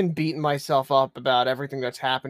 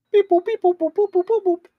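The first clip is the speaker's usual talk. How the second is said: high chirpy dialing beeps; phone beeps; 6 dB louder than usual. Pretend. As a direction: sing the dial tones high. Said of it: "Midas is pulling his phone out".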